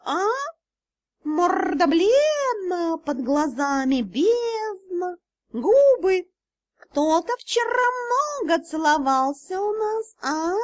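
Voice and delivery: high, slow mock-worried cat; cat spirit teasing the pale girl; fussing cat spirit. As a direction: coo over her like a meddling cat. Cat fusses over the girl.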